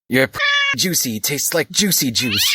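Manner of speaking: first take, savoring it; lustful line. Acted. Lupin to Tonks, the original hammed-up line. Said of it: "blooper repeat".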